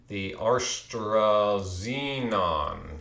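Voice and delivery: "AstraZenon" stretched out very slowly; jokey, long-drawn "AstraZenon"; mock mispronunciation. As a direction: draw out every syllable of "AstraZenon". Deliberately garbled, stretched vaccine name.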